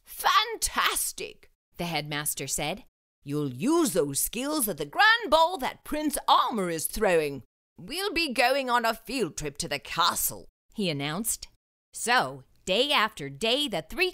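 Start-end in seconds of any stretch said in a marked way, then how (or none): 0.1-1.5 much higher, slow fantastic; delighted headmaster. Acted. Headmaster praising the students, high and slow. 3.2-10.5 kindly headmaster. Acted.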